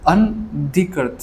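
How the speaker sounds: slow, broken-up reading of अनधिकृत; careful pronunciation. Shown as a demonstration. Teacher checking his reading of a hard word, slow and careful. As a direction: sound out अनधिकृत slowly, part by part. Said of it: "मैं सही पढ़ रहा हूँ".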